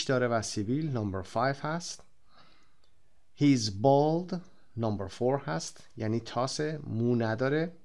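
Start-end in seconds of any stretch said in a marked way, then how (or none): 3.4-4.4 slow model reading. Shown as a demonstration. Teacher models book sentence.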